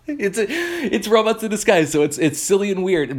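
high, giggly voice through laughter; amused, recovering from a laughing fit; laughing while talking. Real, not acted. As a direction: get the words out while cracking up. Still laughing after own joke.

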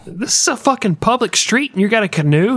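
high, outraged yell at a canoe; baffled bartender. Retold or imitated. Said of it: "And he's like".

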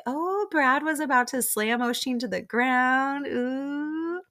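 high-pitched imitation of excited gossip; retold gossip. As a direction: pitch it up, ending on a long high "ooh". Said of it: "Mia tells Lucia, like".

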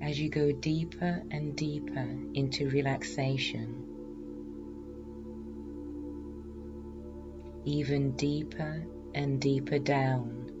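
slow, soft hypnotherapy voice; hypnotic induction voice. Acted. Leads hypnosis relaxation induction.

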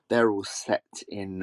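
ordinary delivery

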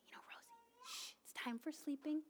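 put-on hushed, gentle whisper to a toddler; graciously. Mom whispering to her early-rising toddler to sleep. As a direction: shush her softly back to sleep. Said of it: "I just say very graciously".

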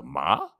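"ma" dipping low then swooping up; third tone. Shown as a demonstration. Demonstrating Mandarin tones, dipping third tone. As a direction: drop the pitch on "ma", then bend it upward. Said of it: "third is kinda like up, down".

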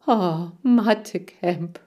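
slow, drawn-out swoon; dreamy gushing. A narrator's reading. Lee gushing about math camp, dreamy and slow. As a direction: melt over the word. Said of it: "schwärmte Lee".

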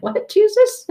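high shocked squeak into a laugh; can't believe Jesus emailed you; incredulous squeal. Acted. Reader getting an email from Jesus, squeaky disbelief.

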